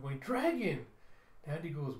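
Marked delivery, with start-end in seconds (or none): 0.2-0.9 put-on high-pitched child's exclamation; high, excited kid voice; excited little kid. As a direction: cry out like a kid spotting something amazing. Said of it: "Mila pointing up".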